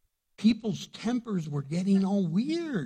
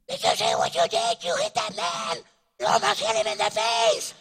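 mother scolding; 5 semitones higher, 3 dB louder than usual. Retold or imitated. Mother scolding her son loudly.

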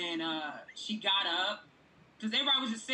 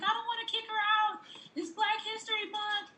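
high, lightly voiced nice host; sweet hesitant host; 13 semitones higher than usual. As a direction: play the kind host, pitched up. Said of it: "She was like".

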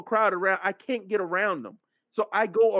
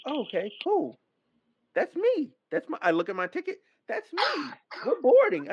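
high-pitched happy recognition; light up at good news; pleased realization; 6 semitones higher than usual. Retold or imitated. Traveler spotting his flight on the screen, pleasantly excited.

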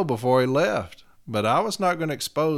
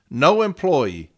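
NO drawn out slowly and hit hard; emphatic reading; 5 dB louder, slower than usual. Retold or imitated. Advocate reading contract language to the arbitrator, emphatic. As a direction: read "No employee" slowly, leaning on No. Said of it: "put in words for emphasis".

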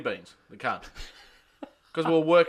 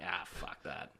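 low gravelly voice; 5 semitones lower, 7 dB quieter than usual. Retold or imitated. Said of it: "he's like".

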